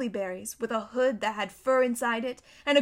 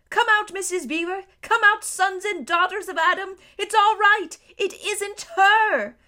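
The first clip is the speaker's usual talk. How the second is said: high, loud calling, slowing at the end; excited shout; 8 semitones higher, 7 dB louder than usual. A narrator's reading. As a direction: call them all out of the cave. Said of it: "he was shouting".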